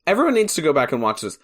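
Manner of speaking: ordinary talk, nothing marked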